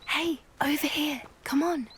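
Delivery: hushed call to come over; whisper. Acted. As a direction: whisper to draw someone aside. Calling someone over secretly.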